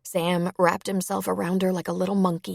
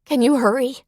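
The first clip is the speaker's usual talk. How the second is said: higher, louder plea with mild tearfulness; tearful; 8 semitones higher, 7 dB louder than usual. A narrator's reading. Sierra begs her brother to hurry, mildly tearful. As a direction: plead at raised pitch, a touch tearful. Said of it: "with tears in her voice".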